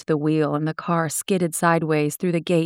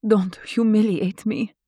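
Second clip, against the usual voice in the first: higher heroine voice, pained; lightly voiced film heroine; proud plea; 5 semitones higher than usual. A narrator's reading. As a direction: keep dignity while pleading. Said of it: "Laura said".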